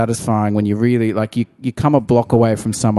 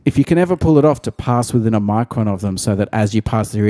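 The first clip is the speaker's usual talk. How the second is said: ordinary talk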